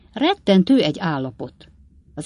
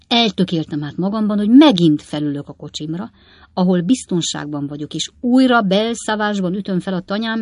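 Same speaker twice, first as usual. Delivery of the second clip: a hint of an emphatic old man; resolute old father; 3 semitones higher, 7 dB louder than usual. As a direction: declare your decision to your son. Old Weller announces his decision.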